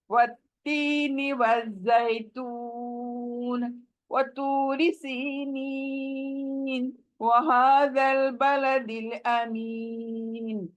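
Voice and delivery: slow, beautified Quranic recitation; melodic recitation. Shown as a demonstration.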